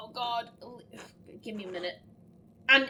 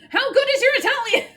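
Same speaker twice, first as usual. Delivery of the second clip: loud, high, quick burst; excited shout; 11 semitones higher, 10 dB louder, faster than usual. Real, not acted. Player cracking the Italian translation, excited high shout. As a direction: blurt it out loudly, high and fast.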